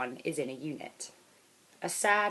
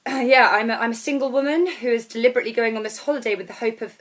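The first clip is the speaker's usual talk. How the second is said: 1 flustered confession; 6 semitones higher, 8 dB louder than usual. Acted.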